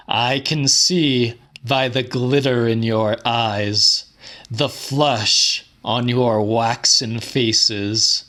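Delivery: usual speaking voice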